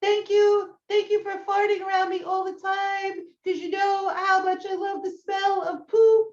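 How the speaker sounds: high mock dog voice; dog's voice reading card. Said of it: "I'll read the card to him and I'll be like".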